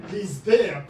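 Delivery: ordinary delivery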